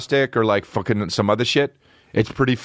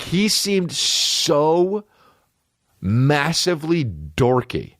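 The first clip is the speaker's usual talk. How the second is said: drawn-out mock; 6 semitones higher, slower than usual. Mocking stretch.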